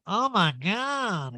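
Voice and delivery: high, drawn-out mock gasp of words; Erica impression. Erica reacts to the short dress, drawn-out mock shock. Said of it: "Erica goes".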